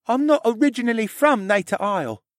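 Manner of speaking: put-on high young character voice; high-pitched, earnest tale-telling; Rylan, shy confessing stable boy. A narrator's reading.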